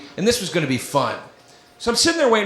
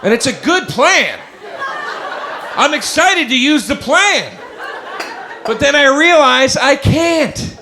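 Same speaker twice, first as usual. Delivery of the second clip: higher, slow, overexcited; mock excitement; 8 semitones higher, 4 dB louder, slower than usual. Real, not acted. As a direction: stretch it out, overexcited. Rising excitement then deflation.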